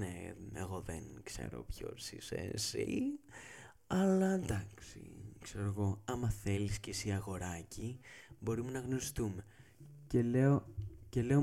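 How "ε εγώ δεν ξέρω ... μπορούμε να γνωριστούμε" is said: teasing come-on, hushed. Retold or imitated. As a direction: purr it like a flirt.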